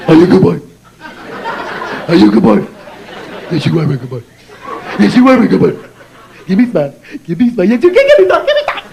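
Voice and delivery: gushing, goofy coo to an infant; idiotic baby talk. Acted. Comic cooing to his infant for a smile, idiotic baby talk. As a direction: babble sweet nonsense, building to a squealed plea. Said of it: "a license to talk like an idiot".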